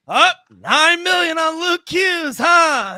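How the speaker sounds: high, shouted taunt, mocking the rival fan; chirping heckler impersonation. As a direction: crow it like a gloating heckler. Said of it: "he's chirping now".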